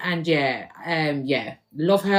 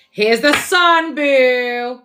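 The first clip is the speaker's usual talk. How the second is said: excited reveal; 4 semitones higher, 7 dB louder, much slower than usual. Real, not acted. Reader reveals the sun card.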